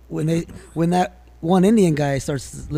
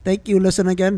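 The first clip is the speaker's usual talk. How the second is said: put-on higher-pitched Indian accent sign-off; 5 semitones higher than usual. Co-host signs off for the Indian listener, Indian accent. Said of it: "he's very good at doing the Indian".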